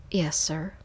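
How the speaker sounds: usual speaking voice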